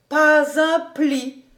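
high, slow, drawn-out admiration; admiring. Acted. Housekeeper stroking master's back in the coat, high admiring voice.

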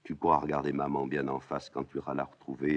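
ordinary talk, nothing marked

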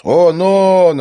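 loud, high confirming shout; high, drawn-out call; calling back. A narrator's reading. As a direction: holler it back with excitement. Calls back from afar.

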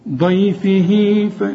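"ضيفه" slowly, ending in a long "hee"; lengthened final vowel. Shown as a demonstration. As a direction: stretch the "ه" of "ضيفه" into the next word. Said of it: "تمدها حركتين عند الوصل".

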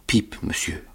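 tiny scared whisper; boy answering the convict in fear; frightened whisper. Acted. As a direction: answer barely breathing.

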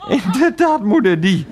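high-pitched, forceful confirmation; emphatic agreement. Acted. Confirms outrageous casting to mother. (verontwaardigd instemmend)

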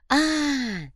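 high, drawn-out "ah"; sudden realisation. A narrator's reading. Копыта understands his boss's hint, a high "ah" of realisation. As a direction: let it dawn on you.

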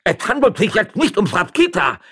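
high, loud shout; jealous shouting. Acted. Magistrate seized by jealousy, shouting. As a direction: yell it in a fit of jealousy. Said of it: "schrie der Stadtrichter".

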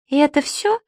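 put-on high little-girl disappointment; disappointed. A narrator's reading. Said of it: "разочарованно протянула девочка". (разочарованно, тонким голоском)

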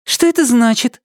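put-on high young girl's voice; light, high girlish asking. Teen girl Amena asks, voiced.

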